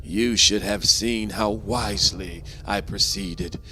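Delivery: ordinary delivery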